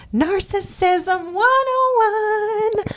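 high, very slow, drawn-out sing-song; mock headline. Host mocking Sandoval's behaviour, sarcastic sing-song. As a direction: stretch it out like a sarcastic textbook title.

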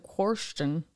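"Question" pronounced "kworsh-ton"; Southern man's pronunciation. Retold or imitated. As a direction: imitate him saying "Question" as "kworsh-ton". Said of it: "but he always said".

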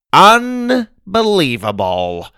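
high, slow, stretched-out word; exasperated disbelief. A narrator's reading. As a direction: draw it out, appalled. Grandma shakes her head, appalled.

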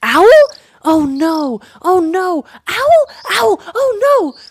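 very high, loud panicked bird cries; swifts' alarm cry. A narrator's reading. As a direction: squawk in hammed-up panic at an owl. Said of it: "picked up the cry".

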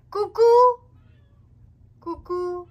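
high, loud call across the garden. Real, not acted. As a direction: call out like summoning a pet. Calling out to the cat.

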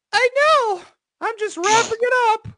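shrill-high mock narrator voice; high girly voice. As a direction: squeak it out as the heroine. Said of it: "I get to do voices now".

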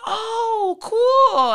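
over-the-top "oh cool" in a high squeal; mock amazed. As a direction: gush over it with exaggerated surprise. She reacts to his Europe claim.

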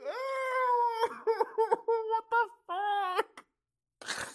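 very high, loud wail with laughter; real crying mixed with laughing; really crying. Real, not acted. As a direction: break down in squealed, laughing sobs. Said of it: "I was really crying".

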